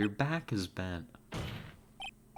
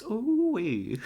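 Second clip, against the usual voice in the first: high, loud cowboy whoop of delight; excited whoop; 11 semitones higher, 5 dB louder than usual. Acted. Marshall whooping over fine ribs, high and loud. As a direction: let out a happy Western holler.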